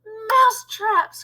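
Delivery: high echoing cheer of witches; excited cry. A narrator's reading. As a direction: cry it back in a high pitch. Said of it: "cried the witches".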